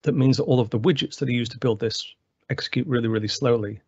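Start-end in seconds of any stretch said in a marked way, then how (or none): none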